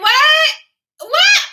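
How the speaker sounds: loud, high-pitched shrieks of disbelief; hammed-up appalled outburst; mock shock. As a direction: squawk in comic horror. Acts out shocked reaction to her voice.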